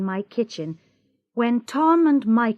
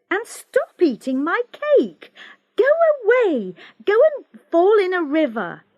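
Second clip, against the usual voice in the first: loud, exasperated shooing; angry; 9 semitones higher, 5 dB louder than usual. Acted. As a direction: chase him off, getting louder. Shooing brother away angrily.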